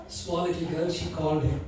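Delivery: ordinary talk, nothing marked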